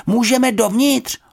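put-on high-pitched girl's shout; worried shout. A narrator's reading. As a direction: call anxiously through a door, high. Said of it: "zakřičela".

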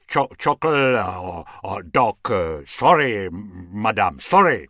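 fumbling, sheepish apology; a lightly voiced grovelling man; awkward apology. A narrator's reading. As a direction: apologize clumsily.

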